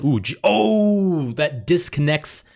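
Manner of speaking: high, drawn-out "oh" of alarm; suddenly spot your own mistake, pitch shooting up; dismayed surprise. Real, not acted. Chess player sees the queen disconnected, dismayed. Spots a blunder mid-game.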